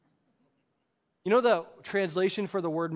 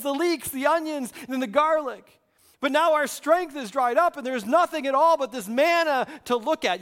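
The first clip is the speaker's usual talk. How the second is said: whiny complaining; 7 semitones higher, 5 dB louder than usual. Acted. Israelites moaning to God about manna, loud high whine. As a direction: whine it out like a put-upon complainer. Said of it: "You gotta say it with a whiny voice".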